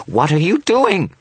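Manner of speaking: slow, very high panicked shriek; drawn-out, loud, high scream; high-pitched shriek. A narrator's reading. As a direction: shriek it in horror. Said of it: "his voice rising to a high-pitched shriek".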